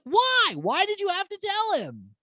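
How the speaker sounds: very high, exasperated wail; frustrated outcry. Real, not acted. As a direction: cry it out in disbelief, pitch way up. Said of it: "so frustrating".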